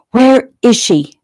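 loud, slow, high demand. A narrator's reading. Officer demanding his answer from Keegan, loud and slow. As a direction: bark the question at him, slowed. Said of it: "the officer demanded".

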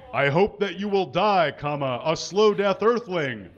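loud jeering roar; roaring civilian. Acted. Angry civilian roars at the caged captain. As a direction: roar it at the captive. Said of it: "roars a civilian".

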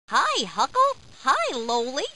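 plain reading voice

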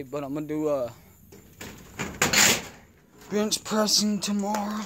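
talking around a lip of dip; mumbled with tobacco in lip; lip full of dip. Real, not acted. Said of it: "I already got a dip in".

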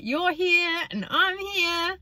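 high, slow mock-cheery friend voice. Mimicking a friend's baffling remark, slow high mock cheer. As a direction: drag it out like a bemused parody. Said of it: "It's like".

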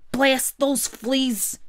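slow, drawn-out furious curse; raging gorilla. Acted. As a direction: spit the curse out in slow rage. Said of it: "he raged".

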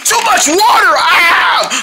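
high, loud panicked yell; panicked scream. Acted. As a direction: scream as water sprays everywhere. Sink bursting, panic.

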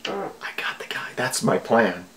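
mock-sly hushed plotting; sneaky whispered plan; conspiratorial whisper. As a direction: whisper the scheme. Mock villain scheming aside.

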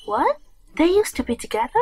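high, loud startled question; astonished, pitch jumping up; shocked. Acted. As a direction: blurt it out in disbelief. Shocked by the revelation.

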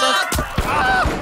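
high-pitched panicked call; alarmed high yelp. Real, not acted. Enemy on them, alarmed.

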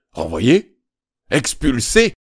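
loud, appalled shout; a father stunned by the news; loud disbelieving outburst; shocked father. A narrator's reading. Father hears son expelled.

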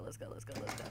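urgent whisper. Real, not acted. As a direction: hurry yourself on, whispering. Whispered urging.

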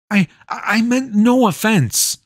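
high, stammered apology; scared boy apologising to the witch; timid apology. A narrator's reading. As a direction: apologise quickly in fear.

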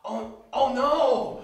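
loud, high, slow wail of alarm; drawn-out acted panic; horrified cry. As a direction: cry out as you are attacked. Pilot is attacked by zombie.

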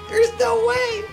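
very high, strained exclamation; disbelieving cry. Real, not acted. Streamer who cannot believe the loss, high disbelieving cry. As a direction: squeal it in disbelief.

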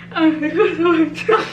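giggling while lips are pulled wide; laughing through stretched lips. Real, not acted.

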